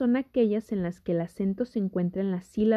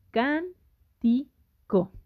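"can, ti, co" in three separate beats; syllable by syllable; 3 semitones higher, slower than usual. Shown as a demonstration. Teacher counting from start to end of the word, syllable by syllable. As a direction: break it up as "can", "ti", "co", a pause after each. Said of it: "que la separes en sílabas".

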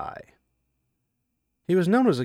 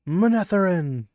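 war cry; 6 semitones higher, 6 dB louder than usual. Acted.

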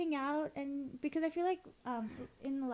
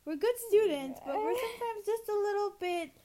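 high, loud, laughing confession; 8 semitones higher, 8 dB louder than usual. Real, not acted. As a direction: giggle through the words. Laughing self-mockery.

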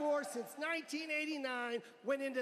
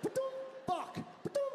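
ordinary talk, nothing marked

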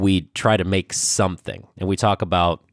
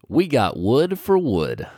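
slow, proud title reveal; slow title announcement; 4 semitones higher, slower than usual. Acted. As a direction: present the title slowly.

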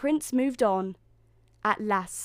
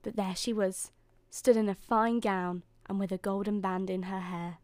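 usual speaking voice

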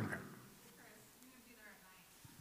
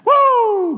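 high, loud pulpit whoop; piercing shout of release; exultant whoop; 12 semitones higher, 8 dB louder than usual. Real, not acted. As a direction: let out a real celebratory "woo". Whoop after preaching climax.

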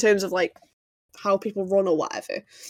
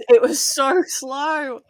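slowed down; 4 semitones higher, much slower than usual. Real, not acted. Mocking the slow chase scene.

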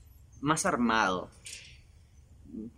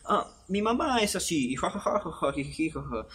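mock hack comic; 4 semitones higher than usual. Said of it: "la gracia de".

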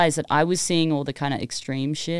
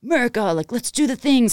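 raised, put-on all-American hype; gung-ho enthusiasm; 6 semitones higher than usual. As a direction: cheer it on like an eager gun fan.

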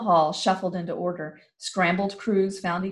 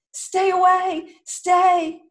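very high, loud, slow warning; cornered ranger warding everyone off; desperate shout; 12 semitones higher, 7 dB louder, much slower than usual. Acted. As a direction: shout them back in panic. Traitor threatens with hatchet.

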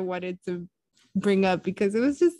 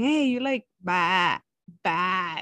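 imitating a lamb; slower than usual. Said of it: "So then I started going".

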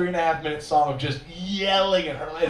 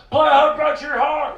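higher, louder call from the back of the room; shouted request; 11 semitones higher, 6 dB louder than usual. Retold or imitated. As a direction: yell it across the bar.

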